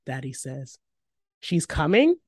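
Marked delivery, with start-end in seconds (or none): 1.4-2.2 higher, louder rising question; startled, voice lifting sharply; surprised exclamation. A narrator's reading. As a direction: exclaim it in disbelief. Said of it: "I exclaim".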